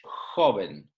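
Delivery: joven with the j as a strong breathy h, HO-ven; strong H sound. Shown as a demonstration. Teacher shows learners the jota in joven as a strong h. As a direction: start joven with a hard raspy h. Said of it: "It's like a strong H".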